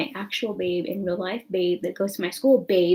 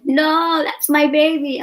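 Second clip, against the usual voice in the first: higher and louder mock of a doting mother; protective mom; 7 semitones higher, 8 dB louder, slower than usual. Imitating his mom protecting her son, high and loud. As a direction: defend your baby boy, playfully. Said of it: "And his mom's like".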